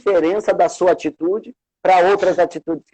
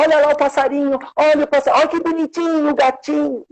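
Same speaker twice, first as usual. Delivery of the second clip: put-on much higher cooing baby talk; distracting a child; 12 semitones higher, faster than usual. Adult distracting a crying child with high cooing baby talk. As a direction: coo at a crying toddler to point out the birdie. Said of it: "Então fala".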